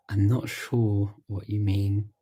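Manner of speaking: ordinary talk, nothing marked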